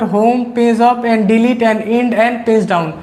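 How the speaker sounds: very slow listing of key names; slow dictation. Shown as a demonstration. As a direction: spell it out for students taking notes. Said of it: "मैं बहुत आराम आराम से बोल रहा हूँ". (धीमी गति से लिखवाना)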